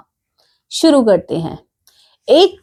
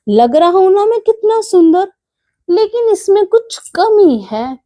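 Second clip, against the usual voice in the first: much higher, preening little voice; vain mouse; 9 semitones higher than usual. Acted. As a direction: admire yourself in the mirror, delighted. Mouse admires himself in mirror. (शीशे में खुद पर इतराता चूहा)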